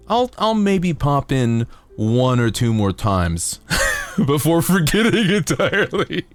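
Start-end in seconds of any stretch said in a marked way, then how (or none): 4.3-6.3 laughing. Real, not acted. Laughs through his own joke.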